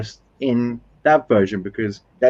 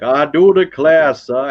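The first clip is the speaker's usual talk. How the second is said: slow, drawn-out mock Tennessee accent, a bit louder; mock Southern accent; 5 dB louder, slower than usual. Mocking English actors faking a Southern American accent. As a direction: ham up a bad Southern belle accent. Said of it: "speak like people from Tennessee".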